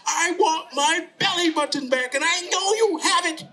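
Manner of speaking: put-on high, insistent child voice; high-voiced boy standing his ground; demanding little boy. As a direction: insist on it like an indignant kid. Tommy demands it back.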